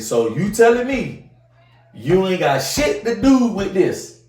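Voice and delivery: loud, slow, high challenge; angry challenge. Retold or imitated. Father challenging the grandpa on the phone, loud and slow. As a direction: drag it out loud and accusing. Angry retort on three-way call.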